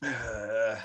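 ordinary talk

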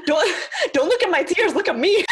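laughing through a mock plea. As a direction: play a teary demand for attention, laughing. Voicing what she wants while angry-crying.

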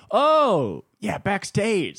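high, loud "oh" of sudden delight; hamming up a friend's joy; mock excited. Friend learning it's only backstage, mock excited. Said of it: "he was like".

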